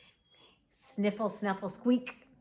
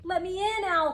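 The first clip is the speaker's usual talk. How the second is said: high urgent call to be let in; crying out; 11 semitones higher, 4 dB louder than usual. A narrator's reading. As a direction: cry out high and louder. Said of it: "cried Rabbit".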